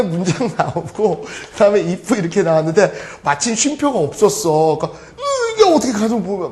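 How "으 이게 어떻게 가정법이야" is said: mock baffled student. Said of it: "막 이런 애들이 있었거든".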